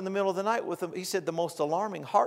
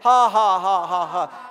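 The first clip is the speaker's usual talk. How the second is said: loud, deliberate run of "ha"s; dry forced laugh; 7 dB louder, slower than usual. Retold or imitated. Preacher imitates laughing through chest pain, dry forced laugh. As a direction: push out laughter without feeling joy. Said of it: "Brother Hagin said".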